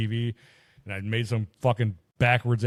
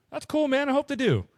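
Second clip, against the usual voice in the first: mock cheerful. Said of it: "I'm like".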